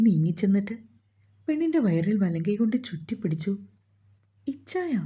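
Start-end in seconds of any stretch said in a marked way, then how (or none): 4.5-4.9 high, soft sleepy-wife call; tender little murmur, lilting high; soft coo. A narrator's reading. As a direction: coo it softly to your husband, pitched up. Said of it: "കുറുക്കിക്കൊണ്ടവൾ".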